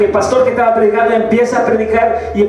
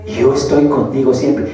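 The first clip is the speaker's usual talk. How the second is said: voz interior de Jesucristo; 8 semitones lower, 6 dB quieter than usual. Retold or imitated. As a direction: speak low and softly, as a divine voice within. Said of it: "escucho una voz que me dice". (voz interior, grave y suave)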